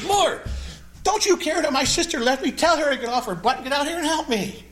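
hammed-up frazzled hostess yelling at Jesus; a playful mock outburst, pitched high. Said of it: "She's yelling at Jesus".

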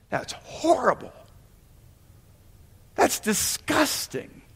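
put-on high, soft voice of a shocked child; appalled young child. Preacher remembering his childhood reaction in church, high hushed dismay. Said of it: "As a young child, I remember thinking".

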